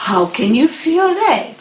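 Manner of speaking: curious little cub, high and eager. A narrator's reading. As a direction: squeak it up like a small cub. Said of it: "said the little bear cub".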